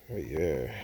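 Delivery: ordinary talk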